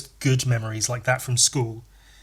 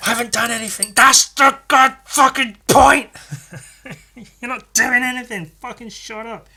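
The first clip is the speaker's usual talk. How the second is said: angry retort; 9 semitones higher, 7 dB louder than usual. Retold or imitated. Angry pupil shouting down the girl in maths, heated. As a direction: let the anger boil over at her. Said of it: "I was so fucking angry".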